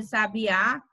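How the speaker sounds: sabiá landing hard on Á; final-syllable stress. Shown as a demonstration. Teacher contrasts lookalike words, stressing the final Á of sabiá. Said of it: "pra gente tá evitando aí a ambiguidade".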